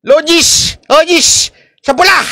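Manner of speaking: high, calling bets across the pit; retold shout of a cockpit crowd calling bets; cockfight crowd shouting. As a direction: mimic bettors yelling at a cockfight. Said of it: "Yung sigawan doon".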